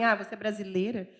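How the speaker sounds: low, soft mock disdain; imitated contempt. Locals rejecting her doctor cousin, imitated contempt. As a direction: mimic someone rejecting her with scorn. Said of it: "O olhar de desprezo que as pessoas te olham".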